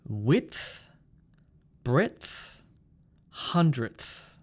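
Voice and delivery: "Width", "breadth", "hundredth", each stopping into th; DTH ending. Shown as a demonstration. Teacher repeats the list, DTH on "Width", "breadth", "hundredth". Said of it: "we have D T H".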